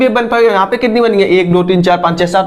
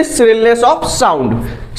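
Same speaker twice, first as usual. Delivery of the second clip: slow dictation; much slower than usual. Shown as a demonstration. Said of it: "dictating definition again".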